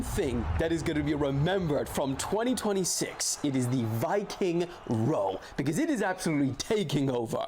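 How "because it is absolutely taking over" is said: usual speaking voice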